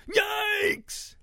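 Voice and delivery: high, loud piggy shriek; shrieking snouts. A narrator's reading. Startled pig snouts shriek back at Ruby, high and loud. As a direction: shriek back in a put-on creature voice. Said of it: "shrieked the snouts".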